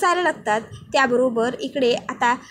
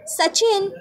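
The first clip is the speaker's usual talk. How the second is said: shouting a name; 6 semitones higher, much slower than usual. A narrator's reading. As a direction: call his name in an excited shout. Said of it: "Priya जोरात ओरडते".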